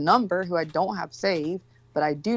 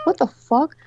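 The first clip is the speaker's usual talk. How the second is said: sudden high, loud what-the-fuck; stunned to find a bag at the door; shocked outburst; 8 semitones higher, 4 dB louder than usual. Retold or imitated. Finding a breakfast bag outside her door, shocked.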